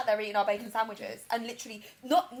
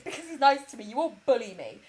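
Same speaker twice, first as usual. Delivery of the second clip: mock defensive reply; 3 semitones higher, 4 dB louder than usual. Mum defending herself against bullying daughter, mock defensive. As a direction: say it out as a pointed retort. Mum's reply repeated out loud.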